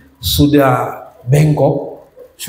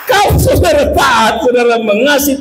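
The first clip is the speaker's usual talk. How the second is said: preaching call; 9 semitones higher than usual. Real, not acted. Preacher calls to congregation.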